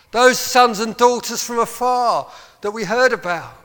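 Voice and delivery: crying out to God. Acted. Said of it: "Have you got the cry in your heart".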